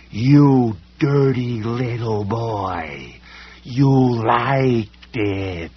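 screaming creature. A narrator's reading. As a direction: build a slow leering shriek. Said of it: "it screamed".

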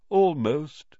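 mildly mournful ghost voice; sad ghost. A narrator's reading. Ghost answering Harry before drifting away, mildly sad. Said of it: "said the ghost sadly".

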